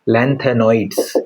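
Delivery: "lanthanod's" stretched out slowly, syllable by syllable; slow repeat. Shown as a demonstration. Teacher repeating the term for students, slow and drawn out. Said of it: "इसको क्या बोलते हैं?".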